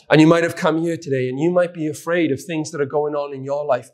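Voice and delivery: normal talking voice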